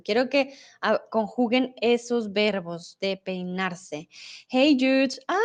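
normal talking voice

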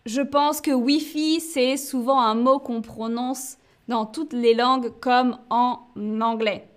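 usual speaking voice

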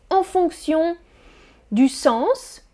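ordinary talk, nothing marked

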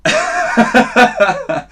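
high, loud staccato laughter. Real, not acted. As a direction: burst out laughing. Lacht nach langer Pause. (lautes, hohes Auflachen)

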